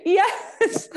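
high, laughing agreement; squealing laugh of agreement; laughing yes. Real, not acted. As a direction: burst into giggles. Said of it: "The lounge chair".

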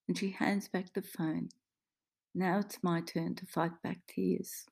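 usual speaking voice

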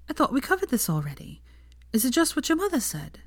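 lightly voiced comforting girlfriend; Hermione's voice. A narrator's reading.

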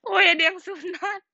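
crying with laughter; laughing. Real, not acted. Laughing while reading comment.

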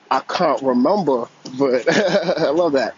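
caller attempting a playful British accent, loud; mock British accent with a laugh; British accent try. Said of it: "Try to work on my British accent".

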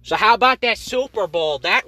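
mock host shout. Sudden shouted host line.

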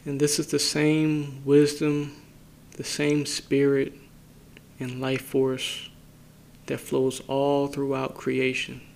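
unhurried phrases, each left to settle in silence; guided meditation. Acted. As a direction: guide listeners inward calmly, leaving space after each phrase.

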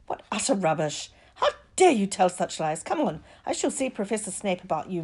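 loud, outraged teacher; indignant scolding. A narrator's reading. Teacher disbelieves pupil and hauls him off, indignant.

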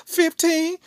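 very high, loud mock girl voice; bashful daughter. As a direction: squeak it out like an embarrassed teen. Voices daughter giving her age.

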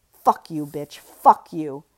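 loud furious curse; loud raging curse. Acted. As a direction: snarl it as you squeeze. Strangling fight, rage.

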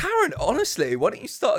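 high, snooty Karen whine; mock Karen voice. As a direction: put on a prissy Karen voice. Mocking Karen with a voice.

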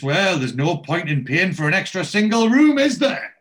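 innocent mercenary. Retold or imitated. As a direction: feign innocence while grabbing the bed. Barry the mercenary vulture.

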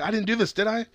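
high-pitched, uncertain mock worry; acted questioning, voice lifted throughout; worried self-doubt. Man questioning if he killed her, acted worried doubt. He doubts his own innocence.

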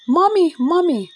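high, loud excited squeal; squealing child. Acted. Babsy running to her mother in the kitchen, squealing. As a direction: squeal it like a thrilled little girl. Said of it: "Babsy squealed".

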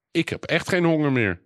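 high-pitched indignant protest. A narrator's reading. Boy insists to laughing family his hunger is gone, indignant. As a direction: insist in a high, affronted child's voice. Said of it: "Bennaby verontwaardigd".

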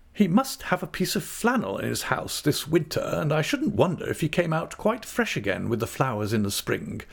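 high character voice, easing down; reassuring aunt. A narrator's reading. Aunt planning Jip's winter flannel, reassuring. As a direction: comfort her with plans for the dog.

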